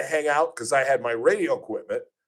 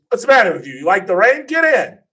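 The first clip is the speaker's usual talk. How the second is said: higher, louder, brusque; gruff offer; 10 semitones higher, 8 dB louder than usual. Retold or imitated. Tommy Lasorda offering a ride in the rain, brusque and loud. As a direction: bark it like a tough old manager. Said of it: "and he says".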